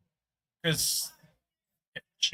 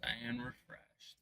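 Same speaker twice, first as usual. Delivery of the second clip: whisper; 5 semitones lower, 15 dB quieter, much slower than usual. Pretend. Echoing partner's remark softly.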